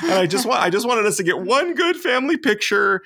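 high, whiny mock sob of a wife with nothing to wear; hammed-up teary wail; imitating crying wife. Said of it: "crying because she's like".

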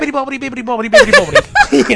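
high, giggly nonsense babble; mock gibberish, breaking into laughter; silly nonsense voice. Goofing with silly British voices.

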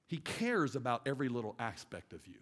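ordinary delivery

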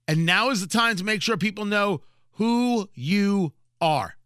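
ordinary delivery

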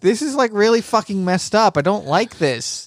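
high, dismayed whine; mock distress. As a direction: react in mock horror, pitched high. Horrified reaction to broken victims.

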